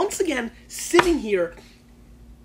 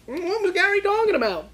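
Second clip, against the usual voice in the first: high-pitched mock voice, loud; squeaky mocking impression of a fan; imagined viewer; 11 semitones higher, 4 dB louder than usual. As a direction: imitate a baffled viewer in a high put-on voice. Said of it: "and they're like".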